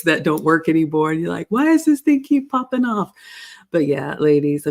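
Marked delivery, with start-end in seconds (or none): 1.5-3.1 high-pitched, puzzled mock complaint; mock frustration. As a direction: imitate someone fed up with a gadget, pitch jumping up. Mimics frustration with failing Velcro strips.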